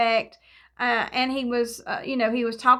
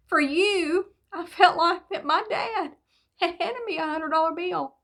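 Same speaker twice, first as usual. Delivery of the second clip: high, halting, tearful-sounding voice; choked up; 6 semitones higher than usual. Real, not acted. Speaker moved as if her dad had handed her the gift, choked up. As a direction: push through it, real emotion rising.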